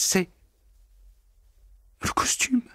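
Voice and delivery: plain reading voice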